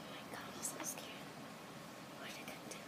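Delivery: very soft, frightened whisper; scared whisper. Real, not acted. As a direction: whisper it, barely audible, scared. Scared, hushed during the storm.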